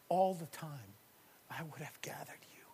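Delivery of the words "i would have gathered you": soft fast whisper. Shown as a demonstration. Voicing God gathering his children, tender whisper. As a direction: hush it as if sheltering chicks. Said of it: "Like this".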